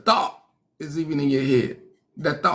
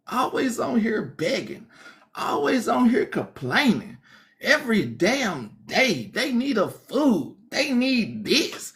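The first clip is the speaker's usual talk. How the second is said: higher, whiny mock complaint with drawn-out words; hammed-up rant about a beggar; mock annoyed viewer; 7 semitones higher than usual.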